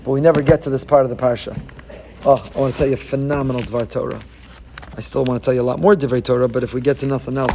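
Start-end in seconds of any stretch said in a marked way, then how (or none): none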